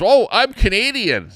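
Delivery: high, loud mock interviewee voice; imitating radio guest. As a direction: pitch it up to mimic the woman being interviewed. Retelling a radio guest's introduction.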